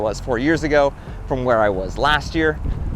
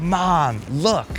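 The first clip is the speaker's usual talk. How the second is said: lightly voiced excited kid, higher pitch; imitated small child; 5 semitones higher, 3 dB louder than usual. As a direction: mimic a little kid calling Mom. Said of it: "kid who was maybe three years old".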